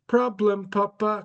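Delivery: put-on high, slow European accent, dropping the article; Barron with a European accent. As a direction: son confessing to dad in a high European accent. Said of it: "he speaks with a European accent".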